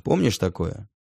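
usual speaking voice

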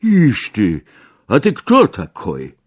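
put-on a boorish drunk soldier snapping back; rude retort. A narrator's reading. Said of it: "grubiańsko odparł soldat". (grubiańska, głośna odpowiedź żołnierza)

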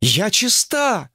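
high, drawn-out stunned protest; stunned objection. Acted. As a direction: object in disbelief, pitched high. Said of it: "попыталась возразить обалдевшая". (ошарашенно возражая)

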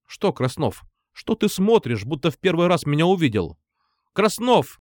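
high, stern schoolteacher lecturing; scolding teacher. A narrator's reading.

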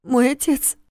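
high broken voice; words catch in her throat; voice breaking. Acted. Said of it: "Её голос сорвался".

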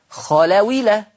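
خلويلة with the hamza dropped and the fatha moved onto the waw, khalawila; read with transfer. Shown as a demonstration. Teacher demonstrating transfer to students, khalawila. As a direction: say خلويلة as one word, khalawila. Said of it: "تقرأ خلويلة".

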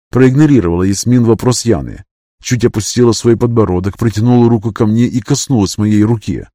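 usual speaking voice

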